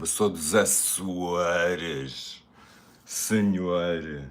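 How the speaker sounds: a light northern Portuguese accent, as spoken in Porto; "Por exemplo, eu sou dos Açores, senhor" with a Porto northern lilt. Shown as a demonstration. Showing viewers how her Portuguese sounds, northern Portuguese accent. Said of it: "tendo a falar um bocado com um sotaque do norte".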